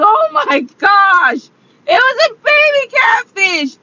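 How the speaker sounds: high, loud cry of dismay; distressed exclamation. Retold or imitated. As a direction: wail it out, drawn-out and loud. Upset retelling her reaction.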